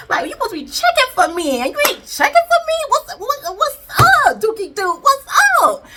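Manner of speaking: high, loud mock outrage at a husband; indignant wife. Wife confronting her inattentive husband, indignant. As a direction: demand attention, pitch climbing into loud shouts.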